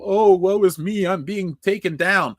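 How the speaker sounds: hammed-up woe-is-me wail; whiny victim. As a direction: whine like a martyr for show. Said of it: "one of those whiny people".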